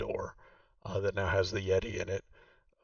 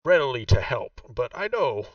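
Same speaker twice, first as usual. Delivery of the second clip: Vaku's voice; 2 semitones higher, 4 dB louder than usual. Acted. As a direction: play the proud host showing her old home. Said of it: "and she says".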